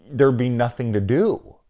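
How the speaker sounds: usual speaking voice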